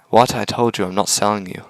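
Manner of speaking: ordinary delivery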